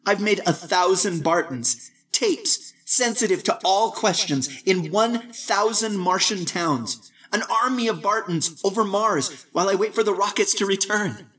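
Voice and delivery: put-on young voice over a phone, matter-of-fact; young Barton. A narrator's reading.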